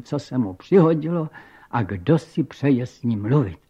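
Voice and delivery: plain reading voice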